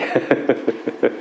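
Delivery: soft, breath-led real chuckle; soft laugh. Real, not acted. Streamer laughing at own low, smooth talk, soft chuckle. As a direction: laugh quietly in short puffs.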